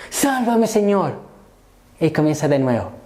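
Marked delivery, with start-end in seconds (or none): 0.1-1.2 high-pitched, urgent plea; crying out. Retold or imitated. Preacher as Peter losing his footing, crying out to Christ. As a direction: act out a renewed cry for rescue. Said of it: "Comienza a clamar nuevamente".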